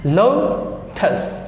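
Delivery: लोटस stretched very slowly, lo-tas; slow two-part लोटस; syllable by syllable. Shown as a demonstration. Teacher sounding out a two-syllable word slowly. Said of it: "टू syllable".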